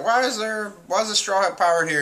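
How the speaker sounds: high-pitched puzzled question; mock bafflement. G-5 men puzzled by Sanji's arrival, mock bafflement.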